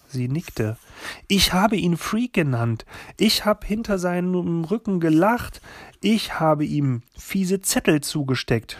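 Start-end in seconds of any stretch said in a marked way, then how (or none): 1.3-8.8 raised-pitch, agitated confession; distressed outburst, short of a full scream; crying out. A narrator's reading. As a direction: blurt out each self-accusation, higher. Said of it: "schrie ich".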